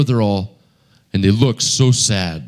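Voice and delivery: ordinary delivery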